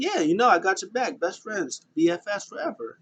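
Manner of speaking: mock cheerful buddy. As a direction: sound like an eager best friend. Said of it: "He was like".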